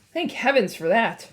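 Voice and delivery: plain reading voice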